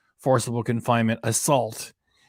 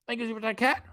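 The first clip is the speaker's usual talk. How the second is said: high cat voice; 10 semitones higher, 5 dB quieter, faster than usual. Pretend. Cat voice thanks viewer.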